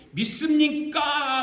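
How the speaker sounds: high, loud, drawn-out question; revival preacher's call. Retold or imitated. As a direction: stretch the last syllable to rouse a congregation. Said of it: "하는 까가 떨어지자마자".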